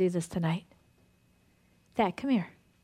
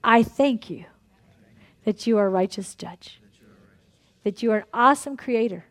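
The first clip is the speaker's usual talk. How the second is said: earnest prayer; 2 semitones lower than usual. Acted. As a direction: pray it out for the whole room. Leads a group prayer.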